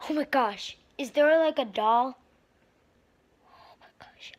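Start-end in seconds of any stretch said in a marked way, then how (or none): 3.5-4.3 scared hushed whisper. Real, not acted. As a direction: whisper in fear in the dark. Exploring the dark attic.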